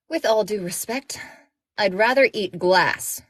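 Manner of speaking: irritated. Acted. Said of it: "my irritated look".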